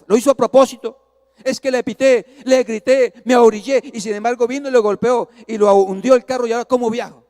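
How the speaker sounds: loud, indignant retold complaint; airado. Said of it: "comencé a zafarme el cinturón airado". (queja airada, en voz alta)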